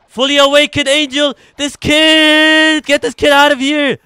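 very high, loud outburst; outraged shouting. Real, not acted. Player wanting a troublesome kid gone, high outraged shout.

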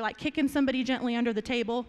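ordinary talk, nothing marked

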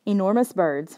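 plain reading voice

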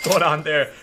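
ordinary talk, nothing marked

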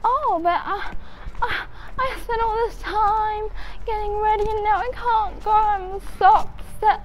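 mock-upset wail, high and stretched; upset complaining. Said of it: "complaining to my mom".

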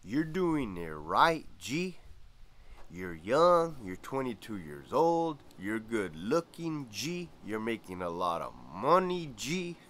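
drawn-out delivery, lifted lilt on each G; Greñas impression. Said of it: "He kinda talked like this".

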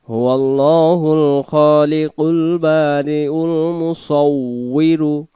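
slow melodic tajweed chant; Quran recitation. Acted. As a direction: recite slowly with long drawn vowels.

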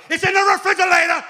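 mock kid's triumphant announcement; shouted little girl. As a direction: proudly yell it like a small daughter. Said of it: "Tell everybody".

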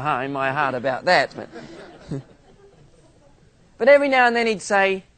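ordinary talk, nothing marked

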